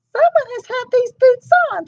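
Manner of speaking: Mama Bear fussing over her boots; huffing Mama Bear. Acted. Mama Bear finds worn boots, high huff. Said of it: "she huffed".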